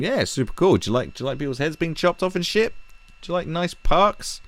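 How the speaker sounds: high, eager mock sales voice; recruiter pitch. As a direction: imitate a chummy recruiter selling it. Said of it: "how good were these recruiters".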